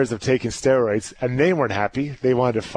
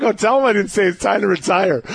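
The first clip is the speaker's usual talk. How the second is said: laughing; 7 semitones higher, 4 dB louder than usual. Real, not acted. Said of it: "I'm like".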